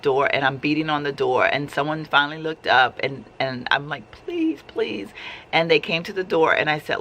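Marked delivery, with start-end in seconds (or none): 4.1-5.1 high, soft pleading at the glass. Retold or imitated. Mother at the locked door begging staff, high soft plea. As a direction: beg them to open up, softly.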